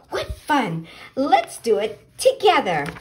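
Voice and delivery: a touch of happy pollywog cheer; happily. Acted. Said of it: "he said happily".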